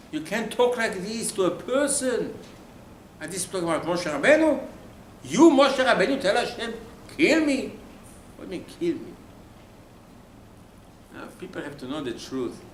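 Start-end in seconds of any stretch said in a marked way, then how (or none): 5.2-9.1 loud, high-pitched rebuke; incredulous scolding. Acted. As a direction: take Moshe to task, voice raised. Incredulously scolding Moshe for his words.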